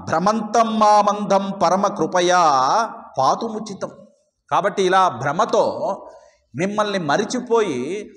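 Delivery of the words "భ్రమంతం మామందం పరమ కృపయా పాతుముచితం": slow chanted Sanskrit line; verse recitation. Acted. Priest reciting the hymn to the congregation, slow and chanted. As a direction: intone the verse slowly and reverently.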